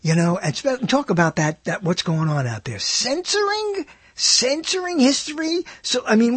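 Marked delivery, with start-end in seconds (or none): 2.8-5.6 high, slow disbelieving echo; incredulous. Real, not acted. Radio host to listeners, outraged disbelief. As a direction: echo the word as if you can't believe it.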